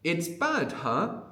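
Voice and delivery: "It's bad" pitched high, with a tag "huh?"; emphatic. Shown as a demonstration. Teacher shows student an exclamation, not a question, emphatic. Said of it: "it's really emphatic there".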